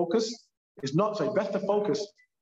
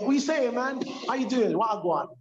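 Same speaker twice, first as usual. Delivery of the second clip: higher, lively London street greeting; London street slang; 7 semitones higher than usual. Retold or imitated. Guest showing how Londoners greet each other, in high street slang. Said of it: "As we do in London".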